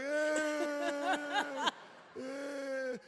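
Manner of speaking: high, whiny half-crying yeah; mock whimpering kids. Kids answering demanding dad, high whimpering mock cry. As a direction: whimper a reluctant yes. Kids' unhappy reply.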